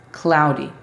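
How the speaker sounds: "cloudy" with the D tapped like a Spanish R; "cloudy", its D a quick light flap; D almost an R. Shown as a demonstration. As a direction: say "cloudy" as CLAU-ri. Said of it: "La D es casi una R".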